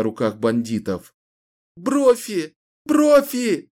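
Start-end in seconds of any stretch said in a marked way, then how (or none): 1.8-2.5 high sobbing cry of the name; sobbing call. Acted. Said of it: "рыдая ... звала она". 2.8-3.7 higher, louder wail of the name, breaking; louder sobbing call. Acted. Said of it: "рыдая ... звала она".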